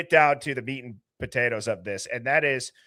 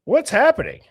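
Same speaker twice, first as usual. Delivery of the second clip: surprised; 12 semitones higher, 7 dB louder than usual. Real, not acted. Guest drops off unexpectedly.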